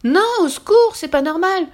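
loud, high cry for help; panicked protest. A narrator's reading. Ogre headed for the pot, protesting in panic. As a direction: protest frantically as you are carried off. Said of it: "protesta-t-il".

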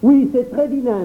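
put-on high, loud cry of a small boy; a boy turning on the donkey; indignant boy. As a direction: scold like a hurt child. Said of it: "s'écria Jacques".

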